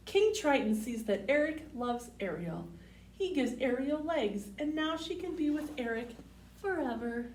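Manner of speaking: clear, sing-song storybook read; picture-book read-aloud voice; reading to children. Acted.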